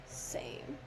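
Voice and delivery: breathy whispered aside. Acted. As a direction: hush it to a whisper.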